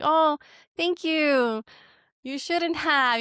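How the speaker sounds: a touch of forced politeness; polite refusal. Retold or imitated. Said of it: "polite refusal".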